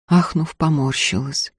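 plain reading voice

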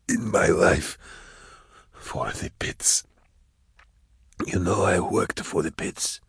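labor out the words with long gaps; ragged dying voice. A narrator's reading. Dying hunter confessing to Drusha, ragged and weak. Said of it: "his breathing was ragged".

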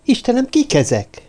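high-pitched worried question; frightened whimper. A narrator's reading. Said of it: "nyöszörögte Lucy Meyer".

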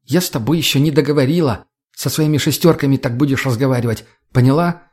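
ordinary delivery, nothing marked

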